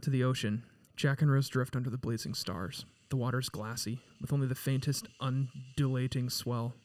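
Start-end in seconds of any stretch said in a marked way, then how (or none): none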